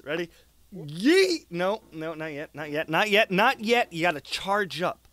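ordinary talk, nothing marked

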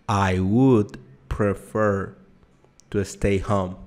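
slow, word by word, pause after prefer; slow, clear teacher model; slow, split demo. Shown as a demonstration. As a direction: spell it out slowly for learners.

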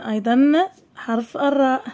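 ordinary talk, nothing marked

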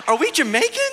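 high, laughing imitation of excited kids; daughters asking. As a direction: voice the girls asking their dad, giggling. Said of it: "they're like".

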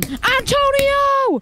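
very high, long-drawn name; triumphant punchline cry. Pretend. Gamer revealing the punchline to a friend, triumphant high cry. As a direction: call out the name high and stretched. Shouted punchline of teasing joke.

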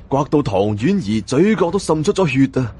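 plain reading voice

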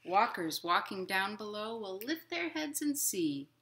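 gentle, lilting picture-book reading; reading to children. A narrator's reading. Librarian reading a picture book to children, lilting. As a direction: read it like storytime for little kids.

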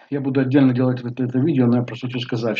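normal talking voice

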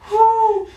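high, loud cold yelp. Real, not acted. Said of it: "I'm like".

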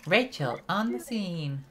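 imitating a game heroine's catchphrase; Rachel. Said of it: "I'm Rachel".

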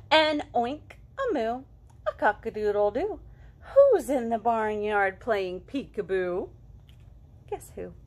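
playful guessing-game read-aloud; reading to children. A narrator's reading. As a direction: ask each guess who with a bright high lift. Reading peekaboo book to children.

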